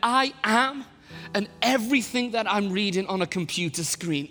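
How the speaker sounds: anguished cry for help; desperate prayer. Retold or imitated. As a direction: plead with God at the end of your rope. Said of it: "my prayer was this".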